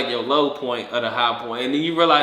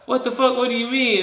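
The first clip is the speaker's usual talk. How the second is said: high-pitched confused questioning; bewildered; 10 semitones higher than usual. Acted. Dead person questioning the grateful crowd, bewildered. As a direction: demand an explanation in comic disbelief.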